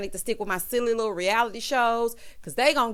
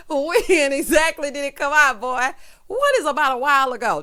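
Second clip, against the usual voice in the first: louder, laughing through the questions; laughing disbelief; 4 semitones higher, 7 dB louder than usual. Real, not acted. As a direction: demand an answer while still cracking up. Laughing at Trigg's vague answer.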